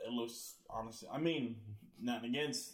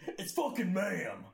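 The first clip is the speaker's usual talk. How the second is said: raised, drawn-out indignant retort; mock an offended customer, pitched up and slow; imitated angry outburst; 7 semitones higher, slower than usual. Angry customer correcting the guy behind the counter, imitated outburst. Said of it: "like, the voice is deep".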